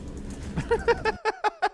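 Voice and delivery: laughing. Real, not acted. Reacting to deposition clip.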